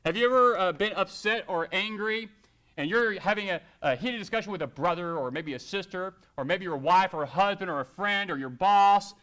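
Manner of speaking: ordinary talk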